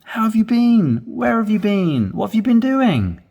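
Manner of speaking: high, eager questions; delighted to see a friend; excited. Shown as a demonstration. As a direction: sound really interested in them. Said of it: "it's more excited".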